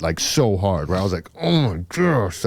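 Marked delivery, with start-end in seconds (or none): 1.4-2.4 retold shock. Reacting to the hard fall retold.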